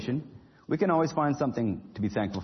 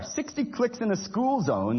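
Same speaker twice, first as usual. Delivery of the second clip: chiding; 7 semitones higher, slower than usual. A narrator's reading. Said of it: "the cop chided".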